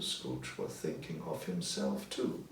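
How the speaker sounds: plain reading voice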